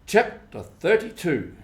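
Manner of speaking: ordinary delivery, nothing marked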